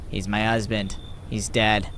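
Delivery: low, drained voice; weary widow. Acted. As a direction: state it wearily as the exhausted traveler.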